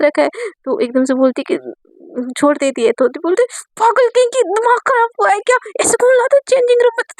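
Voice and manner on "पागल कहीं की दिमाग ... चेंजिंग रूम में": high, fast furious scolding; outraged rant, pitched up and rushing; angry scolding. Acted. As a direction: tear into her for dragging you in. Divya scolds after being released.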